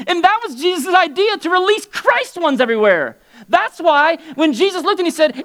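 fired-up sermon delivery; full-force preaching. Acted. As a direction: preach it out to the whole room.

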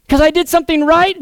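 high, loud surprised question; incredulous. Acted. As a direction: blurt it out in disbelief.

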